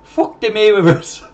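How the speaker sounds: put-on high, loud, slow Irish accent. Streamer doing his friend's Irish accent on request, hammed-up Irish. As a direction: belt it out in a playful Irish accent. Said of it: "Come on, the Irish accent".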